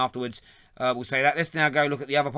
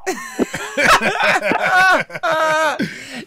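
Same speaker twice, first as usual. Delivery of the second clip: long loud laughter; 13 semitones higher, 6 dB louder than usual. Real, not acted. Host cracks up at troll.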